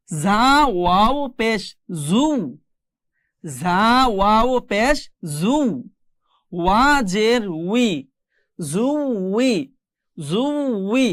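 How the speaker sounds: slow, stretched "ঝা ওয়াও পেশ", building to "জু উই"; spelling drill. Shown as a demonstration. Teacher reads out the exercise, drawn-out drill to "জু উই". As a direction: spell each syllable slowly, then join "জু" and "উই". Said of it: "আমরা বাকিগুলো একটু পড়ার চেষ্টা করি".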